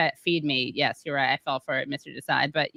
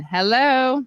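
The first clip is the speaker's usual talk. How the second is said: normal talking voice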